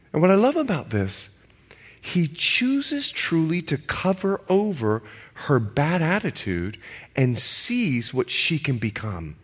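normal talking voice

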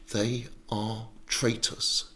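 slow, soft, menacing hiss; quiet hiss. Acted. Saul suddenly very quiet about the priests, quiet hiss. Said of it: "he hissed".

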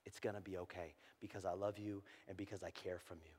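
hushed whisper into soft, low voice. Acted. God reassuring his despairing child, whisper easing into soft low speech. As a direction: reassure very softly, starting in a whisper. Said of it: "we should hear him whisper to us".

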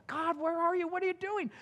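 high, loud anguished plea to God; complaining cry. Acted. Preacher voicing Daniel's complaint, a loud high cry. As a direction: cry out in despair. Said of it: "could have moaned and groaned and complained".

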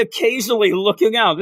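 ordinary delivery

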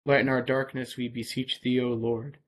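normal talking voice

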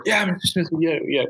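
put-on high, eager kid voice nodding along; agreeing students. Teacher imitating students agreeing with him, high and eager. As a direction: chirp it like students humoring their teacher.

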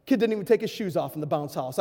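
usual speaking voice

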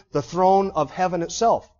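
ordinary talk, nothing marked

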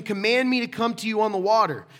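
loud, high-pitched plea; calling out. Acted. Peter asks Jesus to call him out, calling out loudly. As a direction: shout the request over the waves.

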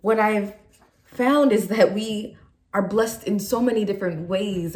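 ordinary delivery

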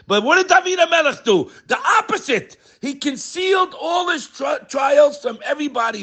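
high, laughing into a shout; excited preaching. Real, not acted. Preacher exclaiming David hid his troubles, laughing then shouting.

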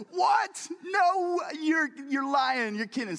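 high, loud, incredulous cry; mock disbelief at wife's celebrity story; shocked disbelief. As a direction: act floored by the news.

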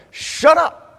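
scolding crowd, hammed-up; crowd rebuke. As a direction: snap at the beggar to silence him. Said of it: "And they're saying".

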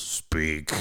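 low, curt phone demand; rude jerk. Retold or imitated. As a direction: imitate a brusque jerk answering. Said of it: "some jerk face who's got a gate".

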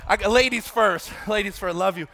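high-energy hollering to the audience; calling out. Real, not acted. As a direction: call across a big room while handing things out. Calling out while handing out eggs.

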